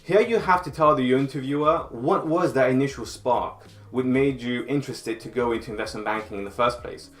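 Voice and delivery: ordinary talk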